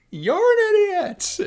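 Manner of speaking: high, loud and slow echo taunt. Retold or imitated. The echo taunting the Grinch again, high and slow. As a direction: stretch out the echo's jab. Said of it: "And the Echo's".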